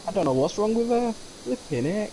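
high-pitched mock Mancunian; exasperated Mancunian relative impression; Mancunian accent. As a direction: take the mickey with a high Manchester accent. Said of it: "the Mancunian is like".

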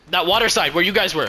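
ordinary talk

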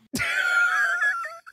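very high, loud shrieking laughter. Real, not acted. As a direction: lose it in a squealing laugh. Laughing hysterically at the joke.

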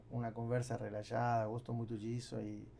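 ordinary talk, nothing marked

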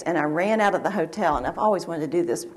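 ordinary talk, nothing marked

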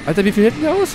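high, loud disbelief; exasperated shout. Real, not acted. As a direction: yell it in disbelief. Enemy won't die, exasperated.

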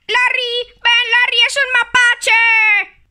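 high, loud alarmed call to a brother. Pretend. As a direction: yell for Larry, panicking over a raccoon. Toy calls brother in alarm.

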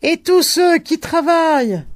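high, loud cry; old man cries out again; shouting. A narrator's reading. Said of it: "cria-t-il encore".

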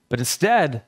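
normal talking voice

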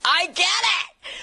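high, drawn-out cry of delight; excited realisation. Retold or imitated. Actor recalling that she grasped improv, thrilled. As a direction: exclaim it as a sudden discovery. Acts out her moment of realisation.

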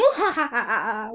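slow, loud villainous cackle; evil laugh. Acted.

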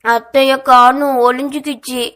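put-on a little boy sobbing out his complaint; crying child. A narrator's reading. Little boy to his father, crying that his aunt vanished. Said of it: "அழுதவாறு உரைக்க".

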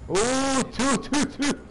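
high urgent callout shout; grenade warning yell. Real, not acted. Enemies spotted during a firefight.

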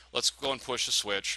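normal talking voice

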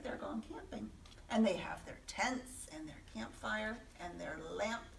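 hushed, secret telling; whispered aside. A narrator's reading. Reading to children, whispering about the campsite. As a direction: point it out in a whisper.

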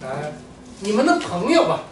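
normal talking voice

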